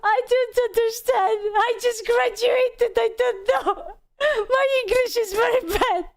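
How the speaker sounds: lost student pleading on the phone, voice high and teary; near tears. Retold or imitated. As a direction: whimper it out like you're about to cry. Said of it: "on the brink of tears".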